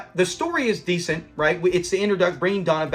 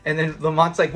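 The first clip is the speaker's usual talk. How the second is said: usual speaking voice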